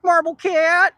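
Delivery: calling out. Real, not acted. Calling out to a cat.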